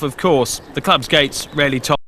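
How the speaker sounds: normal talking voice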